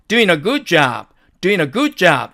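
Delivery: doing a said as doin-a, g dropped; native linking. Shown as a demonstration. Teacher models the smooth form for learners. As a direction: link doing a good job, no g sound. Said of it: "이런 식으로 발음한다는".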